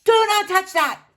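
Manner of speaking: loud, high warning yell. Acted. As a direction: stop him grabbing it. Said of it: "Frank yells".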